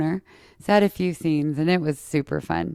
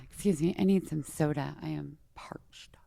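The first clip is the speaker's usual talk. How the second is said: a dry-mouthed whispered aside; 2 semitones lower, 9 dB quieter than usual. Real, not acted. Telling viewers she needs soda, whispered.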